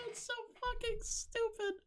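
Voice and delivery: tiny high voice through laughter; real laughter swallowing the words; helpless laughing. Real, not acted. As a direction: squeak it out while cracking up.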